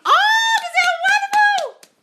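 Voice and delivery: very high, loud slow squeal; thrilled shout. Real, not acted. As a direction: shriek with joy. Thrilled reaction to viewer.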